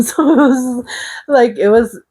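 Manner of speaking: high, laughing giggle through the words. Real, not acted. Laughing while recalling people shouting her name. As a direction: let a giggle carry it up high.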